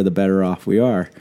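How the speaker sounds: ordinary delivery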